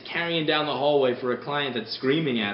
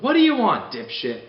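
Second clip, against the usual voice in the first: much higher, louder retort, dropping on dipshit; snapping at the caller; 7 semitones higher, 8 dB louder than usual. Retold or imitated. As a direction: bark it at an annoying caller. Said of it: "close to picking it up and going".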